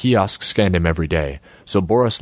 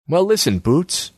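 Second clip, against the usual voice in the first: high-pitched street tough; high, cocky gangster voice; gangster character; 10 semitones higher than usual. A narrator's reading. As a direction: pitch it well up to butt in. Gangster pipes up at last.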